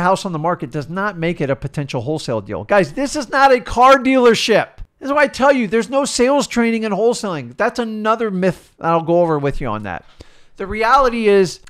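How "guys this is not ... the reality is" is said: high, emphatic insistence; worked up. Real, not acted. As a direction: get carried away driving the point home. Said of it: "I get all worked up".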